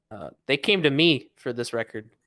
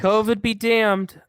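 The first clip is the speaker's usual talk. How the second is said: triumphant; 10 semitones higher, 7 dB louder, slower than usual. Real, not acted. Cheering end of the year.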